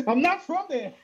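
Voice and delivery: high-pitched mock protest; exaggerated exasperated denial; fed-up actor. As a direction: imitate an actor losing patience with fans.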